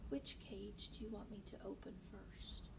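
soft, understated question; quiet voice. A narrator's reading. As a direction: ask it quietly and evenly. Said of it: "Melissa's quiet voice startled both of them".

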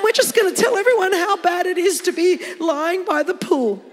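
high, gushing, overdone pity; mock sympathy. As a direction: coo with hammed-up pity, building to a loud whine. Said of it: "he didn't get down and go".

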